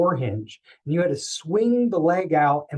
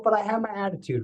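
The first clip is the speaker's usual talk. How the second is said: ordinary talk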